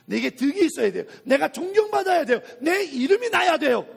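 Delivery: building to high, loud insistence; mounting self-important demands; insistent mock demands. Mimicking self-seeking believers' mounting demands.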